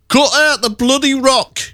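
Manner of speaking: high, slow mock northern club owner; a hammed-up northern grumble; northern accent. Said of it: "it wouldn't have sounded northern".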